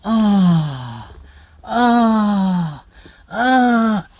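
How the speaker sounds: long sighing "ah" moans; mock moans, drawn out and falling; imitated pleasure moan. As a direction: moan like a client loving a massage. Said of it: "they start moaning".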